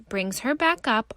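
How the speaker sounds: usual speaking voice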